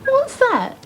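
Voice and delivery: sudden high startled question. Acted. Mother startled by the talking dog. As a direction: jump at the strange voice.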